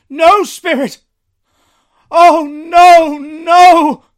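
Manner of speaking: high, loud, terrified cry of no; Scrooge's frantic plea. Acted. Scrooge pleading with the ghost, terrified cry. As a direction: beg the spirit in horror.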